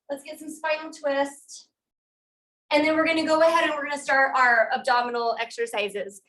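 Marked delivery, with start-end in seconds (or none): none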